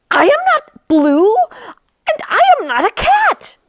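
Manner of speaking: high, indignant dog voice; protesting dog. Acted.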